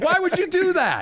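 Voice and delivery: high, incredulous shouted question; exasperated yell. Acted. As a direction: shout it in disbelief. Heckling the man in the story.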